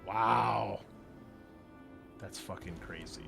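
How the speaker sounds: ordinary talk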